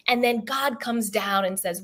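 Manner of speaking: usual speaking voice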